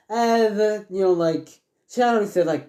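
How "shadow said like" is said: usual speaking voice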